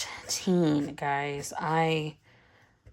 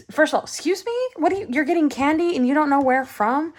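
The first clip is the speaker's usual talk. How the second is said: much higher, outraged excuse me; mock indignant mom; 9 semitones higher, 3 dB louder, faster than usual. Mom demanding to know where son gets candy. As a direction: grill him like a shocked mother.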